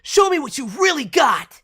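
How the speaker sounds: high, loud battle taunt; action hero voice. Acted. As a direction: shout it like a fighter hero. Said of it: "Very action-y".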